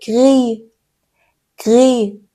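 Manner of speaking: گری said as French gris, final S silent; slow گری, cut off with no S; French word demo. Shown as a demonstration. Teacher drills students on French gris, گری without final S. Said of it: "اس آخرش تلفظ نمیشه".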